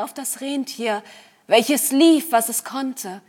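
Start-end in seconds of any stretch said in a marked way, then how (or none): none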